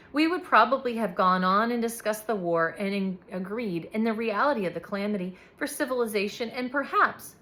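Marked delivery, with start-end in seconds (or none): none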